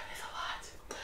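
breathy whisper after a sigh, near silent; exhausted whispered aside; overwhelmed whisper. Real, not acted. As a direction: let it slip out under your breath, worn down. Overwhelmed, sighing about the trip.

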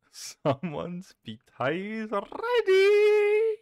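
slow, laughing announcement; giggling as you call it out. Real, not acted. Announces order while laughing.

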